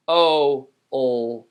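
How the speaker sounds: put-on "o, old" stretched into separate sounds; sounded out. Shown as a demonstration. Teacher models hold, sounded out.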